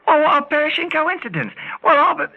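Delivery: high-pitched, amazed exclamation; truly flabbergasted old pal; astonished. Acted. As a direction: marvel at a wild coincidence. Amazed at the coincidence.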